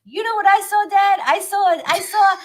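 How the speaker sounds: put-on imagined boy reporting to dad, high and loud; high, eager nine-year-old impression; high-voiced kid. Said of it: "he'd have a high voice".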